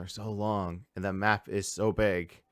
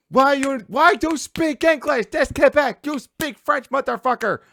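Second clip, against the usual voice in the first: much higher, loud, outraged put-on voice; mock angry Quebecer; 8 dB louder than usual. Mocking Quebecers freaking out over English, shrill mock outrage. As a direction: yell it like a furious Quebecer. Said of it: "they'd be freaking out, going".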